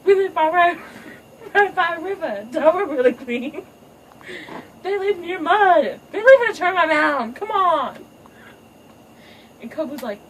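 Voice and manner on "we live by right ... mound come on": high, loud earnest appeal; rally everyone to unity; high hammed-up peacemaker speech; pleading daughter. Kiara's speech continues, laughing.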